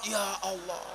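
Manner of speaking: usual speaking voice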